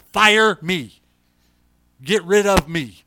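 preacher at full force staking his job; fiery pulpit shout. Real, not acted. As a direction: shout it from the pulpit, slowing on "get rid of me".

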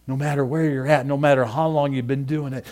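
ordinary talk, nothing marked

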